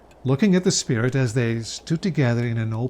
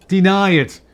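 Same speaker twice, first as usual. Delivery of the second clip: loud, higher outcry; cried out; 5 semitones higher, 7 dB louder than usual. Acted. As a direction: cry it out at the city. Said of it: "cried the spirit".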